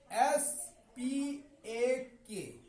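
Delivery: S P A के spelled letter by letter, very slowly; teacher dictating S P A के letter by letter; spelling out. Shown as a demonstration. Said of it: "speak".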